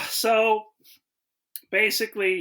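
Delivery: ordinary talk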